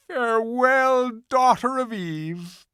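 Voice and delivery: high, slow, earnest faun; a gentle faun giving in. A narrator's reading. Faun promises Lucy to take her home, high and slow. Faun's farewell.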